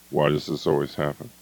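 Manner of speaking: low, soft resigned complaint; deflated victim voice. Shown as a demonstration. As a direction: let the energy drain out.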